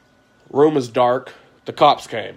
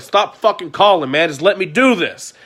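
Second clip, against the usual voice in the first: raised, higher angry retort to a pestering friend; 6 semitones higher, 5 dB louder than usual. Retold or imitated. To a friend who keeps calling, angry and raised. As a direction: snap back, fed up, higher and louder. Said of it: "I got mad at Michael".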